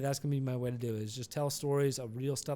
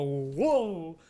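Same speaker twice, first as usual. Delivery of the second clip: imitated startled whoa; 2 semitones higher than usual. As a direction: cry out as if thrown sideways. Imitates passengers as car jerks.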